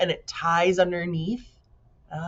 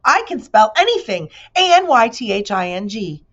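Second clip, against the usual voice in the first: high, loud bragging bee; boasting bee; 5 semitones higher, 8 dB louder than usual. A narrator's reading. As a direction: show off every letter. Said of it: "he boasted".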